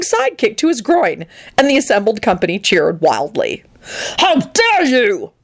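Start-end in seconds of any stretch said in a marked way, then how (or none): 4.2-5.3 high, drawn-out indignant cry; outraged shout. Acted. Arthur defends Lupin from Grindlittle, high outraged shout. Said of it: "Arthur shouted at Grinliddle".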